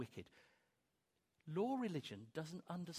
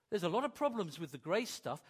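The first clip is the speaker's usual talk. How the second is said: usual speaking voice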